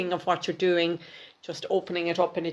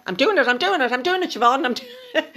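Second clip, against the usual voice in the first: mock exasperated insistence; 7 semitones higher, 4 dB louder, faster than usual. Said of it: "And you're probably going".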